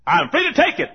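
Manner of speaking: high, loud claim of freedom; emphatic assertion. Acted. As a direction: assert it boldly, setting up the contrast. Asserts freedom as objector would.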